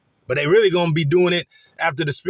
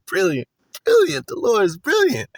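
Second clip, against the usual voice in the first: chuckle, then marvel in a high put-on voice; high-pitched mock delight after a laugh; imitated laughing; 6 semitones higher than usual. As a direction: act out gleeful admiration. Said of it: "he's kinda laughing like".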